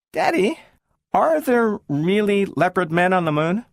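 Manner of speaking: put-on high, wondering child voice; girl asking her father about moon men; curious little girl. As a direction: ask Daddy wide-eyed.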